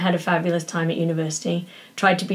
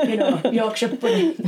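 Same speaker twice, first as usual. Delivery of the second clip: Yorkshire accent; 5 semitones higher than usual. Shown as a demonstration. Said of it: "say it with a Yorkshire accent".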